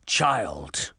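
low, menacing rebuke; restrained roar. A narrator's reading. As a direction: growl it low at an insolent youngster. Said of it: "Mister Canis roared".

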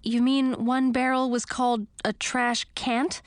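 ordinary delivery

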